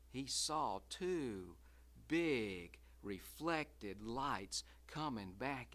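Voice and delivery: slow, low, hushed suspense. A narrator's reading. Narrator spooking listeners, slow and low. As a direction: draw it out to build dread.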